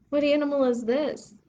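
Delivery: usual speaking voice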